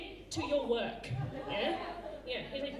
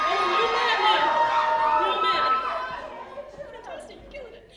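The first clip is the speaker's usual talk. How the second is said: loud, high cheering-on; hyping up; 8 semitones higher, 10 dB louder, faster than usual. Real, not acted. Coach cheering a student's pretend tantrum, loud hyping up. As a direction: egg the student on at full volume, higher and louder.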